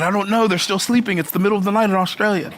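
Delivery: casual Australian reply; lightly done Australian accent. Retold or imitated. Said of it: "my Australian accent's not that good".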